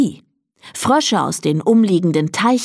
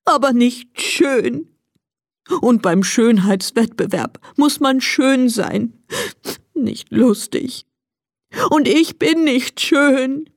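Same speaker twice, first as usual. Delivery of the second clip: sniffling; 5 semitones higher than usual. A narrator's reading. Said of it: "schniefte Emil".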